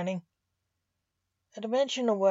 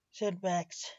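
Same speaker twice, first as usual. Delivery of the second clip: ordinary delivery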